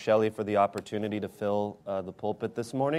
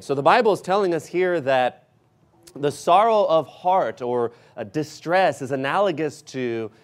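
higher, louder preaching voice; 5 semitones higher, 7 dB louder than usual. Real, not acted. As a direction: preach it out, building up. Preaching with rising force.

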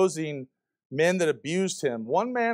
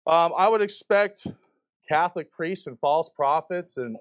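ordinary delivery

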